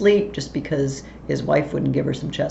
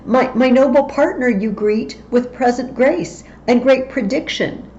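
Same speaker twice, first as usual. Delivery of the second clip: higher and louder, urgent questioning; Banquo challenging; 6 semitones higher, 4 dB louder than usual. Acted. Banquo challenges the witches, higher and louder. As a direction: demand an answer from the witches.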